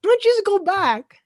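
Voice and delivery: high, loud comic suggestion; exaggerated high sitcom voice; Jerry. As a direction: pitch it way up, mock-casual advice. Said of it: "Jerry's like".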